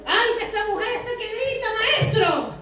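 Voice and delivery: high-pitched mock complaint; hammed-up high voice of grumbling disciples; annoyed disciples. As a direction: whine to the master like a bothered disciple.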